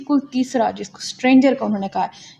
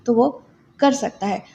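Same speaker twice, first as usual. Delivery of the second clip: usual speaking voice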